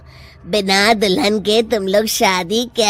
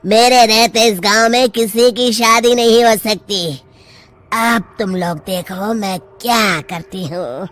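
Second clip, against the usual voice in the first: ordinary talk, nothing marked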